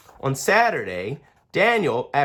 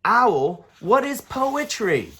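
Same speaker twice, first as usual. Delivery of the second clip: put-on higher, eager child voice; bright curious kid asking; young boy Daniel; 9 semitones higher, slower than usual. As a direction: ask like a curious little boy.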